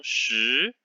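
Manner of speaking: 十 rising like a question; rising second tone. Shown as a demonstration. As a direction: lift 十 upward as if asking. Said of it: "Second tone".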